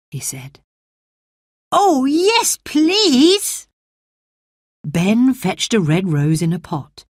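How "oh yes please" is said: high, bright eager yes; can't wait to plant; eager boy. Acted. Colin eager.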